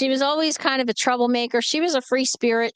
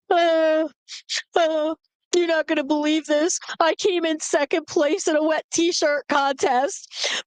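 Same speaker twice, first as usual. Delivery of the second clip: high, wailing imitation of tearful sister; mock crying; 6 semitones higher than usual. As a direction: blubber to Mom in hammed-up distress. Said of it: "she calls up my mom and she's crying".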